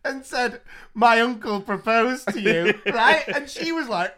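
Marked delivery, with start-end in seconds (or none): 0.9-2.7 giggling through the words; laughing while quoting the child. Retold or imitated.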